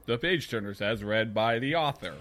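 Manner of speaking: low, measured author-narrator read; lightly put-on audiobook announcer. Shown as a demonstration. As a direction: read it like a solemn title credit. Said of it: "gonna do that voice the whole time".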